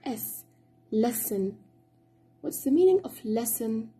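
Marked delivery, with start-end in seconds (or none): 0.0-0.4 "S" given as the sound of double S; double S as one S. Shown as a demonstration. Teacher explaining double S in Arabic, then modelling a single "S". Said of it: "نلفظها".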